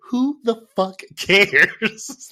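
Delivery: loud, high, drawn-out mock exasperation; exasperated mock outburst. Viewer dismissing the characters' paperwork worry, exasperated mock outburst. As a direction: stretch each word out in hammed-up disbelief.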